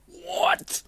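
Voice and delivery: high, sudden disbelief; a high, startled yelp; startled squeak. Retold or imitated. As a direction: jolt of alarm. Punk turning green.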